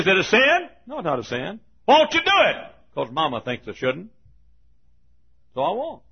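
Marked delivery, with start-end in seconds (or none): 0.0-0.7 high, loud questioner; raised-pitch mock challenge; challenging objector. 1.9-2.6 high, loud mimicked challenge; raised-pitch mock questioning; pressing objector.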